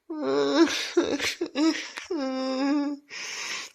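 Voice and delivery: sobbing. Acted. Eiko cries over the clogs.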